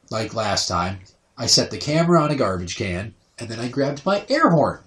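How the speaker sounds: ordinary talk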